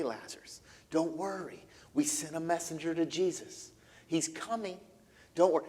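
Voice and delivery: soft, hushed reassurance to a dying man; softly reassuring a sick brother; tender bedside comfort. Acted. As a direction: soothe him quietly at the bedside.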